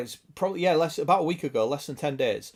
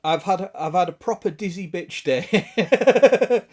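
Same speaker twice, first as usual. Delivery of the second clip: slow, louder, breaking into laughter; laughing; 4 semitones higher, 5 dB louder, slower than usual. Real, not acted. Reading a viewer's mishap to the stream, laughing. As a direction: crack up at the end.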